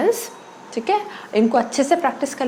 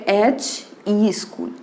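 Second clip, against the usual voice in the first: एच ई school drawn out very slowly, ई held; slow read-out; much slower than usual. Shown as a demonstration. Teacher models the reading for students, slowly. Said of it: "आप इसको कैसे पढ़ोगे".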